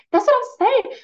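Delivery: high, loud teasing punchline; playful yell. Retold or imitated. Mom joking with kids after yelling, loud playful punchline. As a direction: land the joke loud and high. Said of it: "So I'm like".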